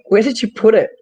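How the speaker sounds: exasperated question. Retold or imitated. Retold exasperation at son.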